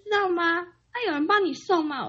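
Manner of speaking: put-on high, slow old grandma asking; lightly voiced grandma on the phone; grandma's voice. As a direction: raise the voice like a fussy grandma.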